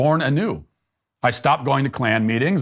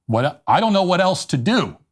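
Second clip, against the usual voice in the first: higher-pitched, hammed-up helplessness; mock desperation; 6 semitones higher than usual. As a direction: plead as if utterly at a loss, tongue-in-cheek. Feigned despair at losing moral guidance.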